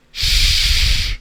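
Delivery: breathy hush; whispered shh; shushing. Pretend. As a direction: shush to call for secrecy. Hushing before revealing Batman.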